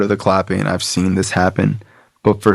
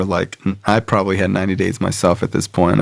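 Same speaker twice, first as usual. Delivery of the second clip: normal talking voice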